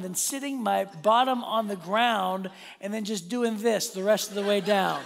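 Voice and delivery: usual speaking voice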